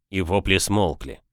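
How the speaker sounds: ordinary delivery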